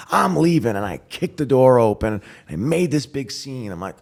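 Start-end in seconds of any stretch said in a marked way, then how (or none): 0.1-0.7 loud, high defiant announcement; defiant exit. Retold or imitated. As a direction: throw it over your shoulder storming out. Retold storming out on family.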